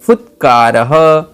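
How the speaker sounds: each word drawn out slowly for pupils to repeat; a teacher says each Sanskrit word very slowly for repetition; slow careful pronunciation. Shown as a demonstration. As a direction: slowly spell out every cluster so the class can follow. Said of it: "इनका उच्चारण मेरे साथ करना है".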